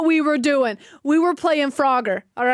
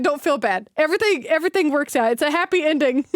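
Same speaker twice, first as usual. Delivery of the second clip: usual speaking voice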